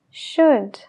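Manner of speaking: "should" said shood, no L; silent l. Shown as a demonstration. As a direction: drop the L in "should". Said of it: "La L". ("should" sin la L)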